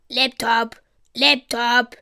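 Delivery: lightly voiced bird squawk; croaking raven. A narrator's reading. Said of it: "krächzt Cedric".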